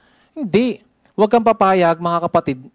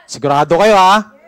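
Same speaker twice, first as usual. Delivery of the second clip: louder repeat; 4 semitones higher, 6 dB louder than usual. Shown as a demonstration. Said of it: "Gusto ko yung malakas".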